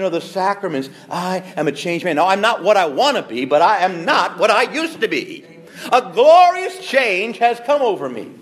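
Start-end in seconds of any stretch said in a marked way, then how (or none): 5.9-8.3 high, slow and ringing; swelling preacher's cry; glorious proclamation. Real, not acted. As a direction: proclaim it like a hymn line. Proclaiming his changed life.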